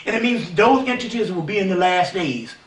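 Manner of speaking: normal talking voice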